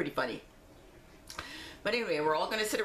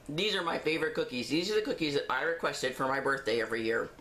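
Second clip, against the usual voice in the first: ordinary talk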